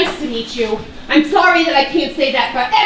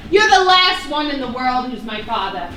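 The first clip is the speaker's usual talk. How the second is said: ordinary talk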